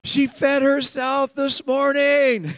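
high, loud, gushing parent; thrilled mom. Acted. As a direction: announce huge news like an overjoyed mom. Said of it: "she's texting Dad going".

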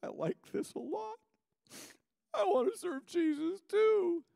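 very high, squeaky mock-tearful voice; overwhelmed new convert. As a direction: squeal it as a comically moved convert.

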